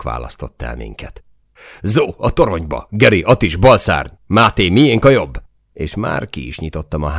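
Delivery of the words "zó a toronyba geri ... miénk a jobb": loud battlefield commands, voice raised; urgent commanding shout; shouted orders. Acted. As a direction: bark out the positions to the squad.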